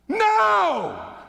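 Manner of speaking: sharp, loud, high yell; shout. Retold or imitated. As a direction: bark a sudden stop. Coach yells at fallen student.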